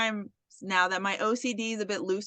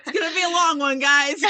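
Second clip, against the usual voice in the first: laughing; 2 semitones higher, 5 dB louder than usual. Real, not acted. Host warning listeners about a long episode, laughing. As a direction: warn them while cracking up. Laughing apology to audience for tangents.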